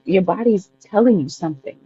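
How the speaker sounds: usual speaking voice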